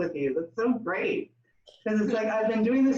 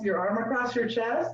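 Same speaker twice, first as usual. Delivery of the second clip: normal talking voice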